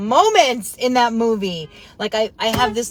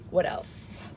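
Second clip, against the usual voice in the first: whispered musing; 8 dB quieter than usual. Real, not acted. Musing aloud, then repeats it.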